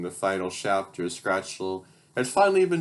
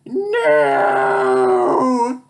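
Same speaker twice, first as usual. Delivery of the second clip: very high, loud anguished no; despairing shriek; villain's scream; 13 semitones higher, 8 dB louder than usual. Acted. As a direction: scream it as you melt. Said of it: "Scratchel scream".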